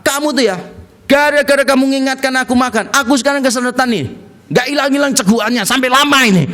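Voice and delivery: high-pitched mock telling-off; angry scolding. Man phones girlfriend to blame her for his choking, mock angry. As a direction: play an annoyed complaint down the phone. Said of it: "dia marah-marah".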